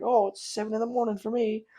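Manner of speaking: Australian gamers telling him the time, in a mock British accent. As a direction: ham up the British gamer again. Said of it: "They're like".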